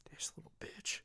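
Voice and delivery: barely voiced mutter; hushed, retold swearing; whispered insult. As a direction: whisper it under your breath. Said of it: "and I was just like".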